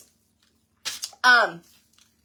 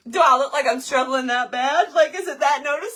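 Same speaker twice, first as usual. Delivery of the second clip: incredulous; 4 semitones higher, 5 dB louder than usual. Retold or imitated. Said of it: "I did say".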